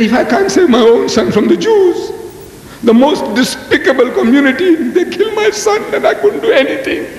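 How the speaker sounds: high-pitched, pleading protest; utterly helpless. Retold or imitated. Maulana quotes God excusing himself, utterly helpless. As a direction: voice climbing high in acted helplessness. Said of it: "Utterly helpless".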